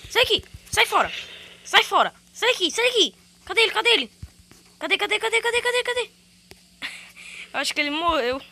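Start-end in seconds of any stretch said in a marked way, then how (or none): 0.1-3.2 loud, high, sharp shouts; yelling get away. Real, not acted. Gamer yelling at an enemy to get away. 3.5-6.1 frantic searching. Real, not acted. Searching for enemy.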